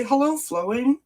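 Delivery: usual speaking voice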